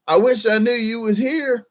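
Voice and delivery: high-pitched imitation of a regretful fan; put-on high complaining voice; mock complaint. Mimics fans' complaint he won't accept.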